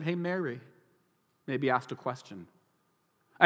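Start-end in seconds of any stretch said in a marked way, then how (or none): 0.0-0.6 casual gentle address. Retold or imitated. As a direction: say the name lightly, as if across the table. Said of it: "he just said".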